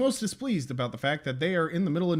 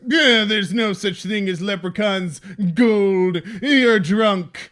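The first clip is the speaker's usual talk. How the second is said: dismissive wife; 4 semitones higher, 8 dB louder, slower than usual. Retold or imitated. Wife dismissing husband's gold story.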